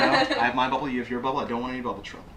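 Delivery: normal talking voice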